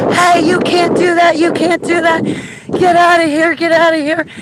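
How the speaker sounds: high, loud repeated yelling; retold shouting. Woman running down block yells at UFO, loud high shouting. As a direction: chase it down yelling.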